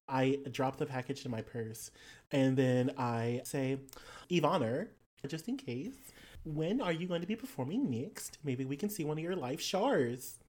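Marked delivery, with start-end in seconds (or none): 4.3-10.4 put-on lilting Australian-accented enquiry; player's character, politely curious. Character asking drag queen about shows, politely curious. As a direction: ask politely with swooping Australian vowels.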